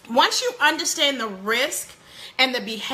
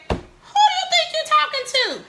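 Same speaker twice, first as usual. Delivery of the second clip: high, sharp mother's rebuke; mock scolding mom; 14 semitones higher than usual. Mother mimicking a parent scolding a back-talking child. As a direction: play the outraged parent snapping at her teen.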